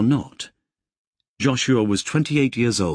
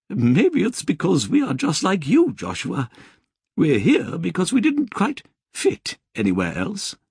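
high, refined English lady's voice; prim British nun, gently lilting; Sister Georgina's polite English accent; 10 semitones higher than usual. Acted. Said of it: "she laughed and said".

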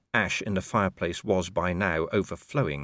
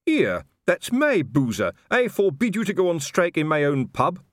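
higher, louder indignant protest; outraged; 9 semitones higher, 4 dB louder than usual. A narrator's reading. Colon protesting to Nobby about his pub. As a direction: defend your pub.

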